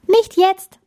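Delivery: high, loud brush-off; firm refusal. Acted. Bella fending off the penguin, firm refusal.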